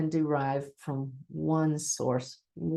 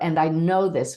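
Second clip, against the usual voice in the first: firm, louder declaration; stating with confidence; 5 dB louder than usual. Shown as a demonstration. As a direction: state it with complete certainty. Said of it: "when you state with confidence".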